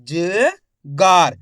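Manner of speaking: ज held, a pause, then a long गार; drawn-out syllables. Shown as a demonstration. Teacher pointing out the long vowel, ज and गार stretched apart. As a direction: sound out ज... गार very slowly. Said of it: "खेच का उच्चारण हो रहा है".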